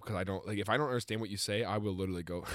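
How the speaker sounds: normal talking voice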